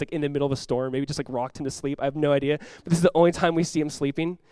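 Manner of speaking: ordinary talk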